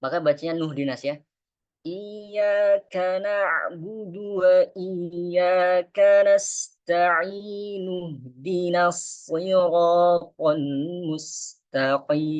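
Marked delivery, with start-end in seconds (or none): 1.8-12.3 slow, higher chanted tilawah; Quran recitation. Shown as a demonstration. Teacher recites the Fatihah verse for the class. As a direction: recite slowly and calmly.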